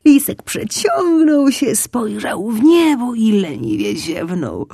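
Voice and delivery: stretched-out high, slow yawning voice; stretching. A narrator's reading. Narrator yawning along as the fox wakes up, stretching. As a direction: drawl it as if mid-stretch.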